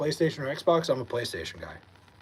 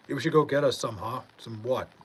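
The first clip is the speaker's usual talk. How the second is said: ordinary talk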